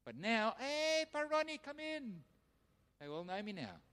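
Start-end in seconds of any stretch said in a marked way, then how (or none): 0.6-2.2 high, slow welcoming call; drawn-out pally greeting; friendly guard. Retold or imitated. Guards greeting him warmly.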